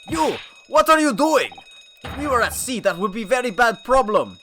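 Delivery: put-on very high, loud foreign-accented yell; angry sailor shout. Burly sailor catching intruders, loud accented shout.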